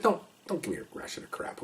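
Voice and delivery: hushed, breathy aside to the partner; muttered retort. Retold or imitated. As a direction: mutter it under your breath, dismissive. Retold retort to partner's claim.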